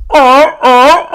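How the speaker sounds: high, loud barking seal call oh oh oh; imitating a buddy's trademark seal bark. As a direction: bark out a seal's call like a friend on the beach. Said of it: "he would do the seal call".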